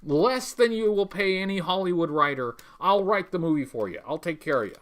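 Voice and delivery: ordinary delivery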